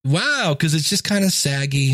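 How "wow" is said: mock surprise. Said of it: "And I just went".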